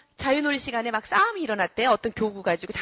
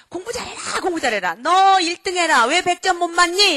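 high nagging scold, building louder; scolding parent; 7 semitones higher, 7 dB louder than usual. Acted. Parent pushing child to be top of class, scolding. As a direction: act out a parent berating a child. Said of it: "이러고 야단치지 마세요".

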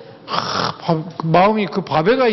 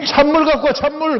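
husband in a hurry yelling for cold water; impatient demand; 8 semitones higher than usual. Retold or imitated. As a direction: bark it at the wife, in a rush.

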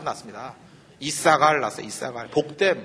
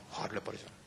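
whispered aside; 8 semitones lower, 18 dB quieter than usual. Real, not acted. Trailing aside.

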